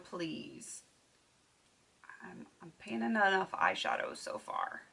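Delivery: ordinary talk, nothing marked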